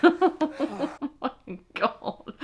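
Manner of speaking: slow, high, laughing exclamation; scandalised laughter. Real, not acted. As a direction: drag it out through laughter.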